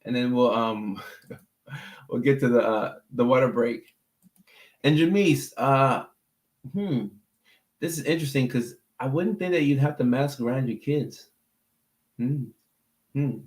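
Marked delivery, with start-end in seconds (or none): none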